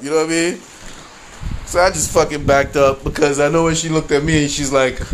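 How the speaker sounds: ordinary talk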